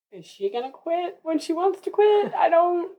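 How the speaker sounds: high-pitched helpless questioning; retold bewilderment. Retelling bewilderment at first breastfeeding.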